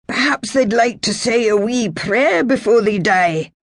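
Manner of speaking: wounded laird asking who the strangers are; feverish old laird. Acted. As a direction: drift between urgency and confusion. Colin's voice.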